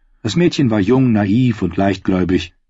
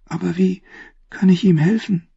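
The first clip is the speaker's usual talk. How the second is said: put-on timid girl; 10 semitones higher than usual. A narrator's reading. Shy servant girl asks.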